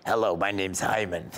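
a hint of retired husband; old Boca husband. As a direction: greet the host as an old husband. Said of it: "this is my husband".

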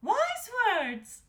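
high, loud approving queen; Queen Estelle. A narrator's reading. Queen praising the young daughter, high-voiced approval. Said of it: "said Queen Estelle".

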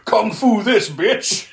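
hammed-up villain one-liner, punched out; Freddy. Quoting the killer as he hits her, mock taunt. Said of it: "when Freddy hits her, he goes".

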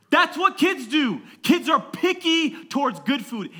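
slow, high, loud declaration; full-force preaching. Real, not acted. Pastor summing up kids' pickiness for church, full-force preaching. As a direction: hammer the point to the crowd.

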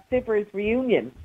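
normal talking voice

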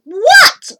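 put-on loud, high exasperated boy; shouting. A narrator's reading. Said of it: "he stood there shouting".

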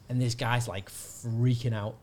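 ordinary talk, nothing marked

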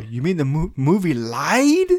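high, loud disbelieving outburst; incredulous movie quote. Acted. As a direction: stretch it out slowly in mock disbelief. Quoting Freddy's outraged movie line.

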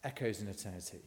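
hushed and low, letting it land; solemn restatement. Real, not acted. Preacher applying the line to Jesus' words, solemnly quiet. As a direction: drop soft and low on the closing phrase. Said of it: "Jesus is saying here".

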